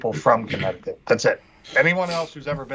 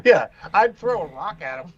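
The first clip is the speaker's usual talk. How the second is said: normal talking voice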